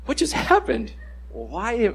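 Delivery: high, bewildered question; shocked at self. Retold or imitated. Preacher mocks himself shocked by his own anger, high and baffled. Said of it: "sometimes I'm shocked".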